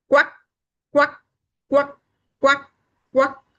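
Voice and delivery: short, high, loud quacks; evenly spaced imitated duck quacks. Storyteller makes the sound the chick heard, sharp quacking.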